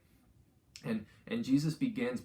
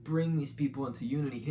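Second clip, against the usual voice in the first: rising fervent preaching, higher and louder; upbeat youth pastor; 4 semitones higher, 4 dB louder than usual. Acted. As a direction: build energy as you preach unity. Sermon builds to fervent preaching.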